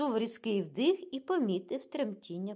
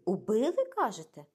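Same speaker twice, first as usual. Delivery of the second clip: louder, higher startled question; exclaiming; 4 semitones higher, 5 dB louder than usual. A narrator's reading. Jacqueline questions Poirot about the maid's murder, exclaiming. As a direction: cry it out in disbelief. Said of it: "вигукнула Жаклін".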